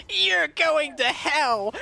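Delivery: high, slow mock yell of a scandalised old woman; a hammed-up old lady crying damnation; imitating old woman yelling. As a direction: ham up a furious old lady condemning her. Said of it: "this one old woman goes".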